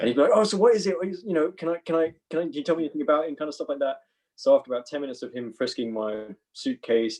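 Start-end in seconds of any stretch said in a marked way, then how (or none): 0.3-3.3 higher-pitched, eager mock chatter; imitated eager guard. Guard chatting while frisking the actor, mock eagerness. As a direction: stumble over keen questions in a raised voice.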